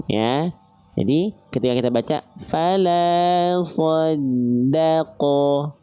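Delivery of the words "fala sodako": fala sodako slowly chanted with thin A vowels; Quran recitation. Shown as a demonstration. Teacher recites the verse slowly for students.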